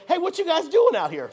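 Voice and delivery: high, loud, clueless call from the car; curious dumb American. Retold or imitated. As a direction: play the oblivious curious tourist, hammed-up. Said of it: "like a curious, dumb American".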